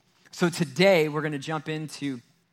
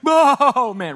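much higher, much louder gushing dog voice; overjoyed dog; 12 semitones higher, 13 dB louder than usual. Retold or imitated. Pastor voicing his dog greeting him at the door, ecstatic high squeal. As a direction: squeal it like a dog losing its mind with joy. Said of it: "and the dog's like".

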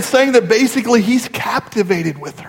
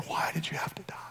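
very soft, breathy whisper; hushed whisper, barely there; 3 semitones lower, 22 dB quieter, much faster than usual. Retold or imitated. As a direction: whisper it as the grieving man. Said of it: "just starts whispering".

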